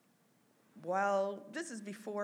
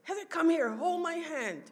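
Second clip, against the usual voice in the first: high, loud, commanding call; imitated summons; 11 semitones higher, 8 dB louder than usual. Mom calling sister to her bedside, imitated loud summons. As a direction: beckon loudly as the mother.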